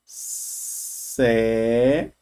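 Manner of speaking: Sérias drawn out syllable by syllable, sé-rias, rising at the end; slow syllable split. Shown as a demonstration. Teacher showing students how sérias divides, drawn out. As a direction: stretch Sérias into its syllables for the class. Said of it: "Sérias é assim, ó".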